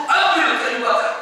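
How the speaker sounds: high-pitched royal order; commanding cry. Acted. King ordering each subject to bring firewood, commanding. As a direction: call out the order. Acts the king's order to everyone.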